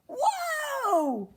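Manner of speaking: a sudden spooky call to startle the muskrat; startled whoa. Acted. The echo calls out to scare Cork, high startled cry.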